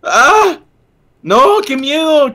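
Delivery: high, hammed-up scared cry; mock fright. Kid hearing the monster eats children, playful fright.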